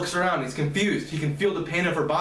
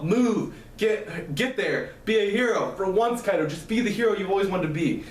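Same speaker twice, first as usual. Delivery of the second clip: raised, urgent pep talk to oneself; urgent self-directed scream; 4 semitones higher than usual. A narrator's reading. Kaido screams to himself to be a hero, urgent raised voice. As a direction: urge yourself on in a moderately raised voice. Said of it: "He screams to himself".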